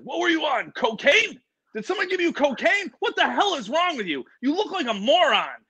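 high-pitched, incredulous mock scolding; scolding dad. Dad to his over-hyped son after the show, incredulous scolding.

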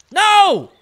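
high, loud cry of protest; big drawn-out gamer yell; loud dismayed shout. Real, not acted. Loses the fish in game.

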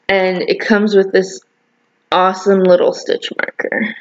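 normal talking voice

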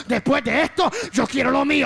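loud, rousing preacher's cry; bold declaration. Acted. Preacher voices David claiming what is his, rousing. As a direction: proclaim it with full force.

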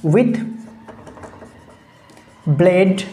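single words with long gaps; dictation pace. Shown as a demonstration. Teacher dictating an alliteration example to students, word-by-word dictation. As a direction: dictate word by word, leaving time to write.

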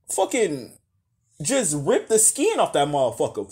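ordinary talk

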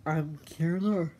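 normal talking voice

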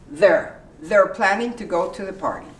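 quick run, "they're" as "there"; fast natural. Shown as a demonstration. Teacher showing fast natural "they're planning". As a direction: rattle it off like a native.

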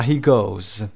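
"he goes" ending in a clear voiced Z; final Z sound. Shown as a demonstration. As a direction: let "goes" buzz at the end. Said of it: "صدای ز بدید در انتها". («he goes» با صدای «ز» در انتها)

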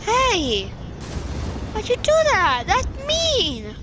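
high, indignant yelp; outraged whine. Real, not acted. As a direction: squeal it out in shock. Friend strikes his car.

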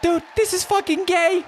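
squeaky falsetto outburst; very high silly voice. Acted. Mock reaction in silly voice.

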